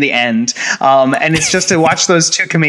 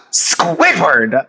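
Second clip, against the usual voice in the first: mock-outraged cry of a name; a touch of horrified SpongeBob; shocked SpongeBob. SpongeBob scolding Squidward, mock outrage. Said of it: "SpongeBob goes".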